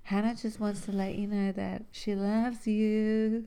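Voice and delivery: smooth late-night radio host. Retold or imitated. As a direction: read it like a love-song dedication. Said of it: "That's what it sounds like".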